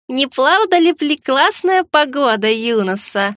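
put-on slow, toothless old lady voice; mumbling old woman. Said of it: "пошамкала я, изображая ... челюсть".

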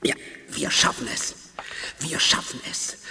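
hushed whisper, straining hard; whispered resolve. Acted. Mutant whispering during the effort, soft and tense. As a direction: whisper it as if summoning all your power.

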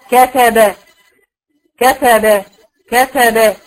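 كتب with each fatha said full and open; three clear fathas. Shown as a demonstration. إظهار الفتحات. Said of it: "ثلاث فتحات".

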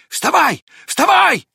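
high-pitched urgent dog's cry; excited shouting. A narrator's reading. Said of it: "закричала она". (взволнованный высокий крик)

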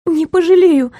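high squeak; squeaky reply. A narrator's reading. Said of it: "пискнула я".

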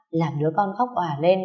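ordinary delivery, nothing marked

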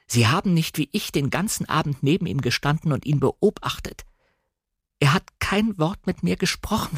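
upset and pleading; distraught protest. A narrator's reading. Tearful heroine protests to Frank.

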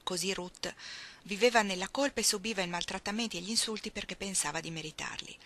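ordinary delivery

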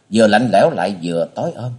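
ordinary delivery, nothing marked

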